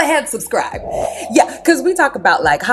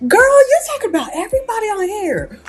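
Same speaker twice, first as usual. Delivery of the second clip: high mock voice of Angela; 9 semitones higher than usual. A gossip host mocking Angela, in a much higher voice. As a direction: ham up a nosy, shrill friend, much higher. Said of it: "I said".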